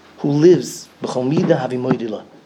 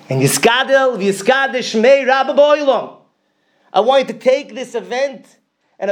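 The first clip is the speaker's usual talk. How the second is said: fervent prayer; 10 semitones higher, slower than usual. Retold or imitated. Father begging God to sanctify his name, high fervent prayer. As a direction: pour out the Kaddish with rising fervor. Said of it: "He says".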